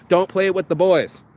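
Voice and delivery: ordinary delivery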